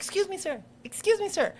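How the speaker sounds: put-on high, bright child's voice; polite little boy. Son repeats to governor.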